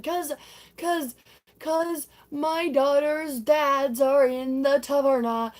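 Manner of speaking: high, loud, slow blurt; hammed-up exasperated reveal; mock confession. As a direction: stretch out the dreaded news loudly. Donna blurts out her confession.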